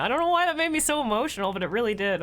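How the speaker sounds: fighting back tears, words catching; choked up. Real, not acted. Streamer telling chat the game scene moved her, voice cracking.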